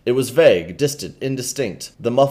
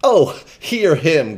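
loud, slow pained groan; 4 semitones higher, 6 dB louder, slower than usual. Acted. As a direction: groan in fake despair. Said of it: "groaned Hugo".

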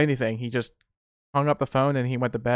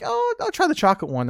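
eager younger self; 14 semitones higher than usual. Retold or imitated. His younger self replying.

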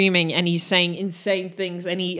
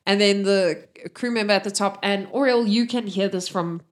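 ordinary delivery

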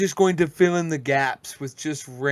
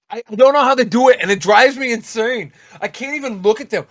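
louder, higher exasperated rant; worked-up rant; 6 semitones higher, 9 dB louder, faster than usual. Real, not acted. Ranting to the podcast guests, louder and higher. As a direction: vent the irritation at full tilt. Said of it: "ranting about people's speech".

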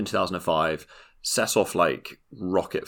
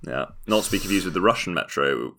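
ordinary talk, nothing marked

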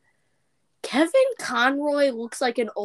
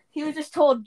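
ordinary talk

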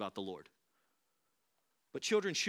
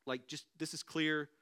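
usual speaking voice

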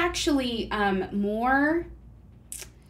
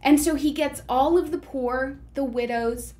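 normal talking voice

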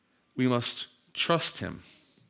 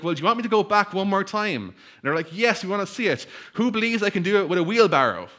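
higher, louder showman call; 7 semitones higher, 4 dB louder than usual. Retold or imitated. Walker sells the wheelbarrow stunt, higher showman call. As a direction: sell the next stunt. Acrobat hyping the crowd.

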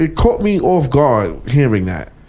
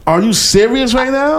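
incredulous; 11 semitones higher than usual. Retold or imitated. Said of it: "I'm looking at him like".

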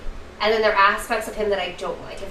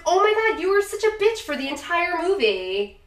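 exasperated retort; 9 semitones higher than usual. Retold or imitated. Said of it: "it's like".

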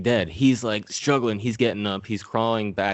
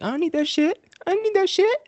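much higher, hammed-up tough-guy voice; mock Sub-Zero; 3 dB louder, faster than usual. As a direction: toss the sword aside in a silly high voice. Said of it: "He's like".

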